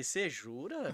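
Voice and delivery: sarcastic mock disbelief. Coworker answers a fed-up colleague, mock disbelief. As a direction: feign surprise to needle him. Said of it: "nem o seu deboche".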